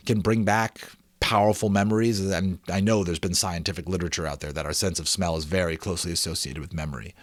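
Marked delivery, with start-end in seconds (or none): none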